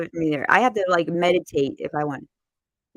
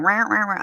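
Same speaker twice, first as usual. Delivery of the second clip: repeated squawking scanner noise; quick vocal buzzer; alarm imitation; 3 semitones higher than usual. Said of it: "it's going".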